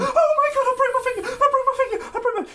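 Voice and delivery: high-pitched hysterical wail, hammed up; exaggerated squealing distress; mock panic. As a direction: mimic someone shrieking over an injury, loud and high. Mocking contestant's panicked screaming over finger.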